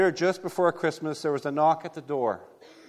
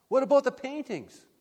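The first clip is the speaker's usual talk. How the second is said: a bidder blurts out, stunned the auction is over; shocked protest; 10 semitones higher than usual. Acted. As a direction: voice jumps way up in disbelief. Crowd member protests auction ending.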